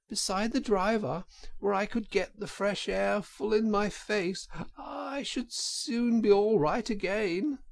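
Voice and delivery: high, faint put-on washerwoman voice; feeble voice. A narrator's reading. Toad in washerwoman disguise.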